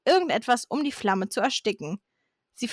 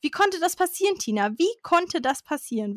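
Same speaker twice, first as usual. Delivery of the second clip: higher-pitched, theatrical dismay; dramatic; 6 semitones higher than usual. A narrator's reading. Dad confronts Tina over the broken vase, hammed-up dramatic. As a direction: repeat the question for melodramatic effect. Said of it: "Weil die so dramatisch sind".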